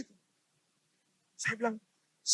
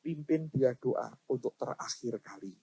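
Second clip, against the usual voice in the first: low, soft and slow voice of God; simple, plain reply; 7 semitones lower, 10 dB quieter than usual. Retold or imitated. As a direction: answer quietly and simply, slowing right down. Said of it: "Tuhan ngomongnya sederhana". (menirukan jawaban Tuhan, pelan dan sederhana)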